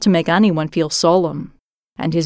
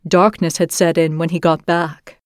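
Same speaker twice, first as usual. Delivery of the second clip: ordinary delivery, nothing marked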